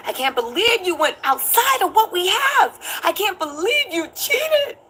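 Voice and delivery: high, slow whiny disbelief; mock distraught. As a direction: play him wailing over the cheating. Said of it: "Then he said".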